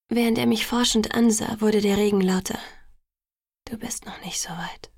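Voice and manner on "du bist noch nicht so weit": soft breathy whisper, lower; barely voiced, gentle whisper. A narrator's reading. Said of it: "flüsterte er".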